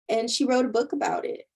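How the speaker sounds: normal talking voice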